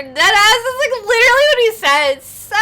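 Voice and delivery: loud, high burst of delight; excited gushing. Real, not acted. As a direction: blurt it out at full volume, thrilled. Gushing over the cheesy line.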